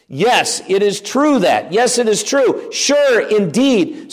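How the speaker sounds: "Yes" and "indeed" pitched high and drawn out; emphatic affirmation. Shown as a demonstration. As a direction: affirm it firmly, lifting "true" high and letting it fall. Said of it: "it provides emphasis for us".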